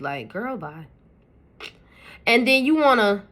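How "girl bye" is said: soft, dismissive little brush-off; sassy girl bye. Pretend. Voicing the brow's attitude to viewers, quiet sassy dismissal. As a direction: toss it off with quiet sass. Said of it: "give it a little attitude".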